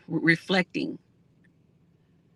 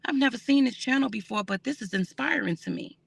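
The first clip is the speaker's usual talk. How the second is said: higher, gushing voice of an amazed new viewer; impressed newcomer; 6 semitones higher than usual. Retold or imitated. Imitating viewers from other areas discovering her channel, higher and amazed. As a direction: play a stranger who just found the channel and is inspired, pitched up. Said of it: "they're gonna say".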